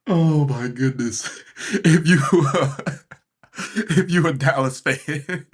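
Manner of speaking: slow, breaking off into laughter; words squeezed out between real laughs; crying with laughter. Real, not acted. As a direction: laugh so hard you stall mid-sentence. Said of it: "I almost crying with laughter".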